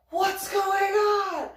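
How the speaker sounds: high, loud, stretched-out yell; alarmed shout. Retold or imitated. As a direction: imitate men shouting as they run up. Mimics guys running up alarmed.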